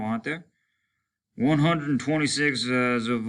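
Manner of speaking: lightly voiced soldier dialogue; voiced soldiers talking. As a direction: lift into the men's weary banter. Narrator voices men's dialogue.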